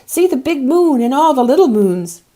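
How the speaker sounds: put-on high, loud child's wonder; a small boy gasping at the stars; excited child exclaiming. A narrator's reading. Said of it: "he exclaimed".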